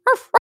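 quick little yaps, very high; high-pitched dog bark. Acted. Dead dog answering through the medium, high imitated bark. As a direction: imitate a small dog's bark. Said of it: "there's another bark".

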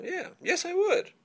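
role-play guest. Acted. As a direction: chirp a high happy yes.

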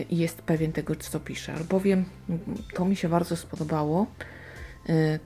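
ordinary talk

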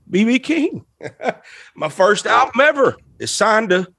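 choked up, high, with little laughs; real emotional. Real, not acted. Guest recalls signing his album to his idol, real choked-up emotion. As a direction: voice lifts and halts through real emotion. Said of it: "I'm so emotional".

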